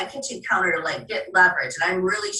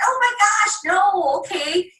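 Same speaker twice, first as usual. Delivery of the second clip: high, startled oh my gosh; mock alarmed gasp; 10 semitones higher, 3 dB louder, slower than usual. Consultant reacting to a customer afraid of her products, high mock alarm. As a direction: act shocked, then soothe.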